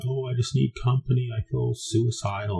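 high, mock-weepy neediness; wife voiced as a crying mess, retold lightly; tearful plea. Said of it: "she's a crying mess".

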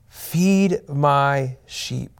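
very slow, tender and drawn out; tender commission from Jesus to Peter; slow gentle command. Acted.

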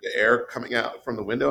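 normal talking voice

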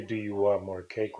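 low, soft, slow "cake" with no questioning lift; without inflection. Shown as a demonstration. Host showing the question works uninflected, no lift on CAKE. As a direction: say it low and slowly, no push on "cake". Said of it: "without an inflection".

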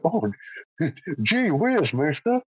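put-on high gee-whiz awestruck kid; wide-eyed old-timey boy; wholesome 1950s kid. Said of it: "he was stood there looking at it like".

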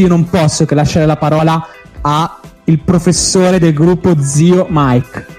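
usual speaking voice

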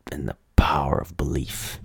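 whispered mystic imitation.

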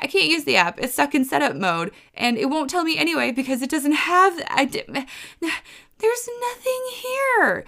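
building to a high exasperated squeak; retelling her own frustration, voice climbing high; exasperated retort. Retold or imitated. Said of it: "And I'm like".

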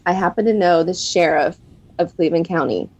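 ordinary talk

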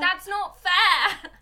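slow, high, drawn-out cry of unfairness; hammed-up indignant shout; loud protest. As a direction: wail it in mock outrage. Protest at mocking edit.

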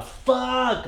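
high-pitched held-back shout; reenacted yell, thinned out; retold frustrated yell. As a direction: cry it out high, not full volume. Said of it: "Like, really loud".

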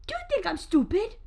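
high, put-on mom voice; scolding mom. Mom confronting the boys, scolding mom. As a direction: demand it as an indignant mother. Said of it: "the mom comes in".

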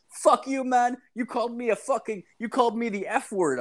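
high-pitched indignant protest; imitated outrage. Store owner rebuking the caught kid, imitated indignation. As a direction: act out an offended man, voice jumping way up.

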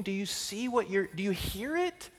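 ordinary talk

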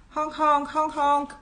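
high, repeated car-horn honks; honk-honk like an insistent car horn; mad horn cry. A narrator's reading. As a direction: sound out the motor's call on one high note. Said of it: "came the mad cry again".

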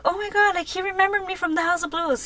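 high, hushed excitement; smitten fan gushing. Retold or imitated. Reliving the singer's wink, high smitten gushing. Acted smitten fan's thought.

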